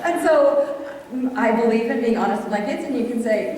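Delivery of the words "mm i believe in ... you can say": ordinary delivery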